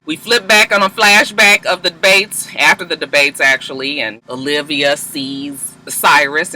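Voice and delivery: ordinary talk, nothing marked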